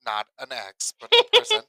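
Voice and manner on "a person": much higher, much louder, squealing emphasis on "a person"; a teasing, overblown correction; mock insistence. Real, not acted. To her co-host, insisting it wasn't an ex, much higher and louder.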